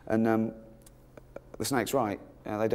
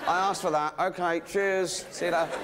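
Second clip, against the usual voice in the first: feigned acceptance; 5 semitones higher than usual. Acted. Snake feigns accepting its punishment.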